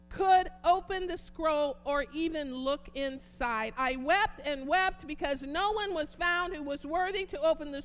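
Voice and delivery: loud, ringing scripture reading; preaching at full force. Acted. Preacher reading Revelation aloud to the congregation, booming. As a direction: proclaim the scroll vision to the church.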